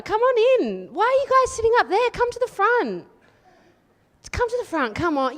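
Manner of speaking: high, bright coaxing call to the crowd. Real, not acted. Calling audience forward.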